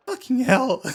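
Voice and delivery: loud, high frustrated swearing; exasperated curse. Real, not acted. Player losing a dice call curses loudly in exasperation. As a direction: burst out cursing at the bad luck.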